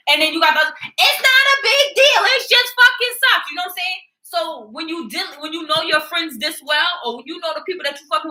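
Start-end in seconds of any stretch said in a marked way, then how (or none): 0.6-3.5 high, slow, brushing-off mock voice; dismissive sibling. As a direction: play down the fuss in a lofty, drawn-out voice.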